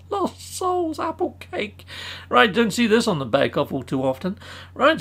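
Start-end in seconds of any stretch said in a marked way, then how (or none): 0.1-1.9 much higher, soft, laughing squeak; giggly high repeat. Acted. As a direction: flip the title into a giggling high voice. Silly repeat of cake name.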